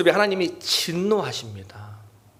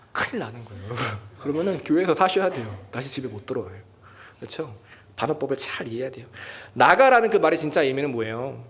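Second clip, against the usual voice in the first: normal talking voice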